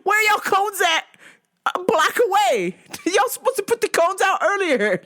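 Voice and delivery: high, exasperated scolding; indignant complaint. Retold or imitated. Complaining to cone guy.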